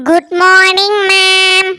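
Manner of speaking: slow, drawn-out school chorus greeting; class greeting chorus. Acted. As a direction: drag it like a whole class greeting together. (மெதுவாக இழுத்து சொல்லும் வகுப்பு வணக்கம்)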